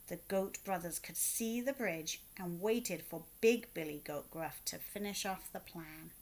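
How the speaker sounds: ordinary delivery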